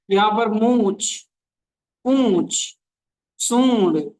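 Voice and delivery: पूंछ and सूंड said with a light nasal vowel; nasal sound demo. Shown as a demonstration. Teacher showing the class the nasal sound in each word. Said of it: "नाक से आवाज निकलनी चाहिए".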